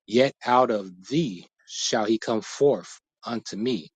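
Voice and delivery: ordinary delivery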